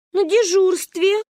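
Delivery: put-on high, drawn-out kid's voice; little child answering. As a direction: answer slowly like a small child. Child answers alarmed grandmother. (детским голосом, высоко и протяжно)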